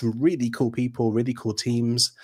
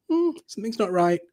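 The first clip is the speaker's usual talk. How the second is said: a light, higher murmur of inner doubt; nagging inner voice; 10 semitones higher than usual. Retold or imitated. Coach recalling his inner doubt, lightly voicing a nagging little voice. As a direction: voice the small doubting voice in your head, lightly. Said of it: "this little voice at the back of your head going".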